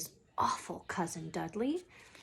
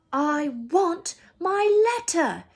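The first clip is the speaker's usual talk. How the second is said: high, loud, slow shout; 9 semitones higher, 9 dB louder, slower than usual. Acted. Boy shouting for his letter, high and loud. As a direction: yell it, every word. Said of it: "he shouted".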